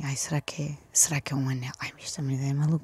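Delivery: hushed, hurried wondering to oneself; whispered inner thoughts. Retold or imitated. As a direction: whisper it like a retold private thought. Retelling her faint, anxious thoughts.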